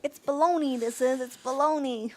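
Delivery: loud, high mock Jersey protest; New Jersey accent. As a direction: complain like a Jersey loudmouth. Mock protest in running Jersey bit.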